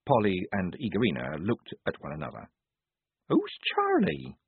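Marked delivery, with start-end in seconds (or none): 3.3-4.3 puzzled. A narrator's reading. Puzzled high question.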